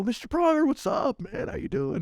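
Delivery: very high, excited retold greeting; starstruck greeting. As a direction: call out to a sports hero you love. Retold greeting of a hockey star.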